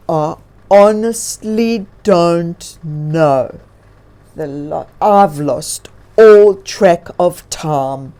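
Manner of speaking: a touch of bear sadness, drawn out; sad bear. A narrator's reading. Lost bear telling the bird, slow and mildly sad. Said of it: "Grizzly answered sadly".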